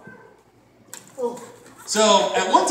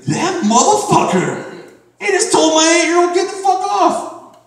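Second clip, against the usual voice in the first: high, loud indignant yelling; furious father ranting, pitched up; a dad raging at a stranger; outraged dad; 13 semitones higher, 6 dB louder, slower than usual. Retold or imitated. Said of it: "And he's like".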